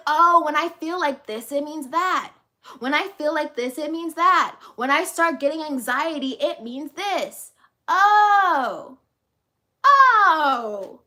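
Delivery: big exaggerated "I get it now" moment; dawning realisation. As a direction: play the penny dropping, ending on long, loud drawn-out ohs. Acting out a sudden realisation.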